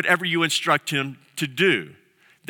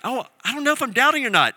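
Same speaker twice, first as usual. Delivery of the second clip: fast, fretful mumble of a worried doubter; anxious fretting; 7 semitones higher, much faster than usual. Retold or imitated. A believer fretting over his own doubts, hurried and anxious. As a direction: rush it like a nervous second-guesser.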